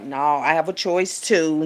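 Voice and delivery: ordinary talk, nothing marked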